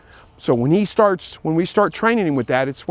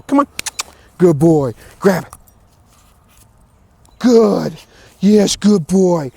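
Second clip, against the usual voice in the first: encouraging dog; 3 semitones higher, 5 dB louder, much slower than usual. Real, not acted. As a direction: cheer the dog on as he gets it. Coaxing then praising dog.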